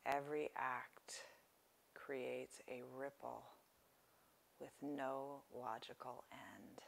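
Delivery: softly paced phrases, leaving space to breathe; guided meditation. Real, not acted. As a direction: guide listeners gently into relaxation, unhurried.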